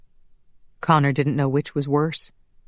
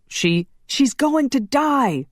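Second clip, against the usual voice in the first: high, slow panicked yell; 10 semitones higher, 3 dB louder, slower than usual. A narrator's reading. Laszlo yelling at Roman that Shanna will die, panicked. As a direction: yell it in alarm. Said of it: "Laszlo yelled".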